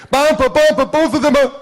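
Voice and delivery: put-on high, loud child calling out; excited little boy. Grandson runs at grandpa calling him, high and loud.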